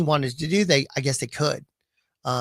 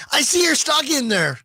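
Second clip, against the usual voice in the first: mock customer; 11 semitones higher than usual. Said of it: "acting card buyer".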